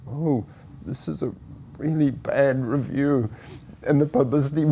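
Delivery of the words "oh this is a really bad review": shaky voice. Retold or imitated. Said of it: "in this shaky voice".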